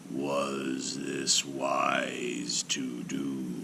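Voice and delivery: very slow, low and soft giant's rumble; a newborn giant asking in a slow rumble; rumbling giant voice. A narrator's reading. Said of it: "his great voice rumbled".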